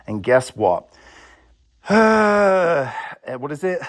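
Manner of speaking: normal talking voice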